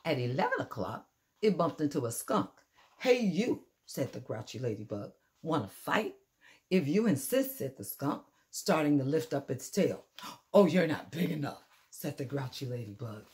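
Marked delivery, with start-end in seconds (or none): 3.0-6.2 loud grouchy challenge. Acted. Grouchy ladybug picks a fight. 6.7-7.6 skunk accepting. Acted. 10.5-11.7 loud brush-off. Acted. Grouchy ladybug dismisses rival.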